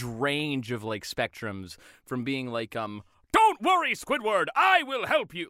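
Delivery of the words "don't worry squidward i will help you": high, loud cartoon heroics; over-the-top cartoon superhero proclamation; SpongeBob. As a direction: boom out a rescue promise, pitched up. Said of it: "classic SpongeBob".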